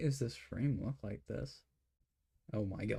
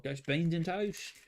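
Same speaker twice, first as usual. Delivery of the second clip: slow, pitched-up British caricature; hammed-up British, drawn out and higher; mock British accent; 5 semitones higher, slower than usual. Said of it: "British people be like".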